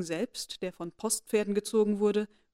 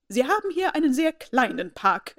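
disdainful lady; 5 semitones higher, 5 dB louder than usual. A narrator's reading. Lady Catherine voiced.

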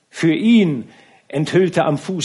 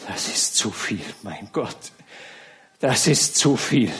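broken, half-whispered despair; falling apart on his knees; despairing stammer. A narrator's reading. The kneeling count stammers to God in despair. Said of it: "Voller Verzweiflung ... stammelte".

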